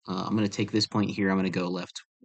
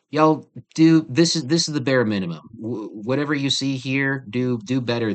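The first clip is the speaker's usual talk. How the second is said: exasperated; 4 semitones higher, 5 dB louder than usual. Real, not acted. Teacher scolding her messy drawing.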